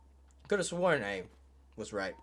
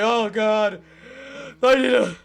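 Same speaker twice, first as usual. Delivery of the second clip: high, loud frightened gasping; scared ghost; 8 semitones higher, 11 dB louder than usual. Acted. Playing the scared ghost, high and panicky. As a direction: voice the ghost trying to calm himself. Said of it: "He's like".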